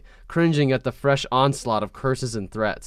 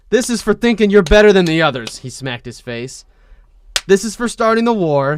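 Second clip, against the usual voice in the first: forceful; 6 semitones higher, 7 dB louder than usual. Acted. Wan slapping boy.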